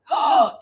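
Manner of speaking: high sudden gasp of recognition; one quick startled intake of breath; sharp gasp. Real, not acted. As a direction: gasp as the memory hits. Remembering apricot scrub.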